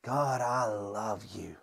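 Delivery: from deep inside. Shown as a demonstration. Pastor leading the congregation, whispering from deep inside. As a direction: breathe "God, I love you" as a whisper from deep inside. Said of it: "let it come from deep down inside of you".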